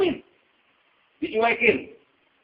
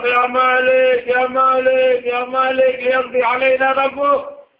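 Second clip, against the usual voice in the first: loud, pleading cries called out again and again; desperate shouting; 8 semitones higher, 7 dB louder, much slower than usual. Acted. Damned souls crying out loud to angel Malik. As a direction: shout up to the angel as if begging for mercy. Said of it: "Mereka teriak-teriak".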